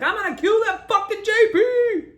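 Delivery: very high, loud furious yell; hammed-up furious father shout, laughing through it; drunk dad shouting. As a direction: scream it like a dad waving a pistol. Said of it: "pulled out his pistol and he's like".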